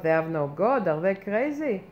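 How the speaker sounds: normal talking voice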